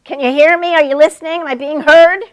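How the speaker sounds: high, loud, pleading imitation; mock pleading young women. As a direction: mimic needy young women begging to be heard. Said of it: "begging to be heard".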